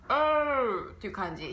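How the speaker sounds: うー said with the tongue pulled back and the sound muddied; a teacher's example of what not to do; muddy R-colored O. Shown as a demonstration. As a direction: show the wrong, throaty version. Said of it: "舌が奥になっちゃって濁るような".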